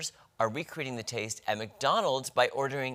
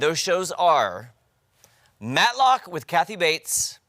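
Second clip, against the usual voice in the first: louder, slow indignant emphasis; upset; 6 semitones higher, 10 dB louder, much slower than usual. Real, not acted. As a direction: drag the names out in outrage. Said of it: "I'm mad".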